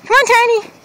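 high coaxing pet voice. Real, not acted. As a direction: coax the dog sweetly. Coaxing the dog.

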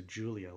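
Giulia said with a J sound. Shown as a demonstration. Car fan showing the changed pronunciation, Giulia with a J. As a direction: soften the G of Giulia into an English J. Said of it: "making the G more into".

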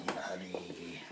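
breathy whispered follow me. Acted. Leading the way into the kitchen, whispering. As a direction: whisper it like a secret.

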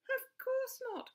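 high little voice crying out; Rosie crying out. A narrator's reading. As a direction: insist brightly. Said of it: "cried Rosie".

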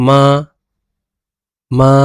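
মা with Chinese tones; tone demo. Shown as a demonstration. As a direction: model the tone on মা. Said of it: "আর এখানে".

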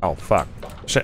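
ordinary talk

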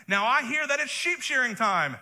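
lightly voiced David nudging Nabal; David's message. Pastor voices David's hinting message.

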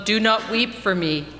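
ordinary talk

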